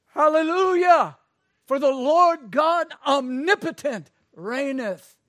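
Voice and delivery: shouted high and loud, a heavenly crowd; preacher voicing heaven's roaring crowd; triumphant shout. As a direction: cry out hallelujah in full voice. Multitude shouting hallelujah.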